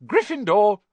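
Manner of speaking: the Sorting Hat bellows the house; hat's shout. A narrator's reading. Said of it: "When it finally shouted".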